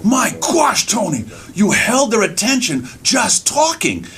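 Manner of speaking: high, astonished praise; amazed fellow performer. Retold or imitated. Performer praises storyteller after show, amazed. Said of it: "one guy came up to me".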